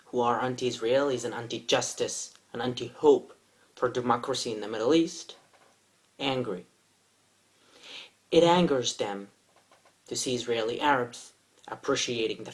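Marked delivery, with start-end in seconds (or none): none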